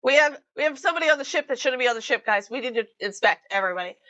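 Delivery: fast, urgent captain orders; brisk captain. Acted. Captain to his crew, fast and urgent. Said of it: "And the captain's like".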